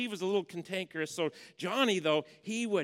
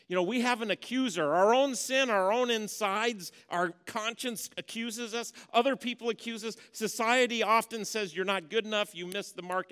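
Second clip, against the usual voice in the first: loud, high, full-force preaching; 6 semitones higher, 8 dB louder than usual. Acted. Pastor urging the church to drop their sin, preaching hard. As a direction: press the point home to the congregation. Pastor builds accuser metaphor.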